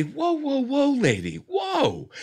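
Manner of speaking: high soothing whoa. Retold or imitated. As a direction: ease in and stop the scene. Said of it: "and they say".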